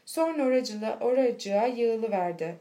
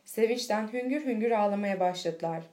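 usual speaking voice

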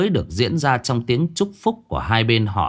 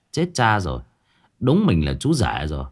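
usual speaking voice

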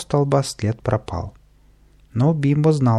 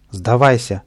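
stern order; 4 dB louder than usual. Acted. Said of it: "приказал".

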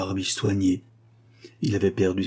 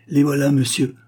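higher, louder alarmed cry; 5 semitones higher, 5 dB louder than usual. A narrator's reading. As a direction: call out to him in alarm. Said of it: "s'écria Euphémie".

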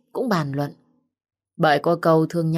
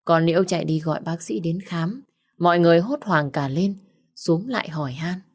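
ordinary delivery, nothing marked

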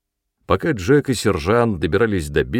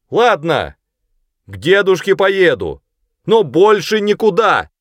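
high, loud conditional yes; shouted agreement; 10 semitones higher, 6 dB louder than usual. A narrator's reading. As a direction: call back firmly from afar. Boy shouts agreement back. (громко кричит в ответ)

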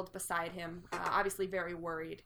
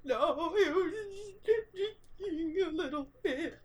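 fake sobbing; 9 semitones higher, slower than usual. NPC still crying.

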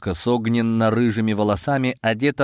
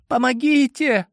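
woman's cry for help; 3 dB louder than usual. A narrator's reading. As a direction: call for help in a high woman's voice. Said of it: "вновь раздался женский голос".

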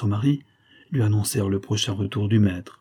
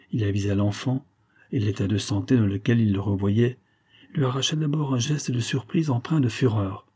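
plain reading voice

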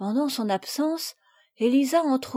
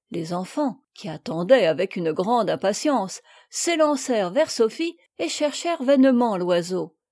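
usual speaking voice

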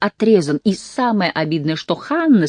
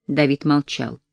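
plain reading voice

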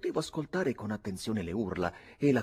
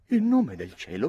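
loud, raised exclamation; shocked demand; 6 semitones higher, 8 dB louder than usual. A narrator's reading. Uncle demands to know about the brawl, shocked exclamation. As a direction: burst out in astonishment.

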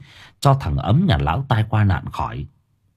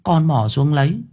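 put-on higher boy's voice recounting his scare; a boy reliving a near drowning; higher-voiced boy telling his parents about the river; young boy telling; 9 semitones higher than usual. A narrator's reading. Boy tells his story.

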